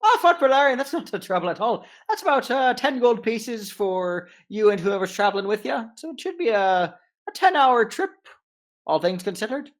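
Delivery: high, loud brisk gnome captain. Acted. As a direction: haggle as a cheerful gnome captain.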